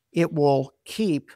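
ordinary talk, nothing marked

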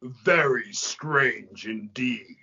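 low, slow whispery demon voice; ominous demon. Acted. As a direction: drag it out, low and breathy. Demon's ominous reply in story.

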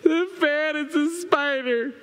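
put-on high, alarmed child's voice; scared little boy. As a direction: imitate a frightened kid pointing something out. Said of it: "when he enters the room now, it's".

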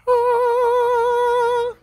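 long, high sung "ah"; mock heavenly-choir note; singing praises. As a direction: hold one high, joyful note. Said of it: "You get to sing the praises".

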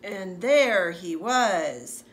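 ordinary delivery

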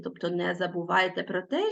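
usual speaking voice

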